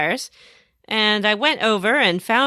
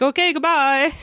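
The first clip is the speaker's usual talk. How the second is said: lightly voiced character, higher; breezy farewell in a put-on voice; a hint of a dismissive NPC; mock goodbye; 6 semitones higher than usual. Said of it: "and he was like".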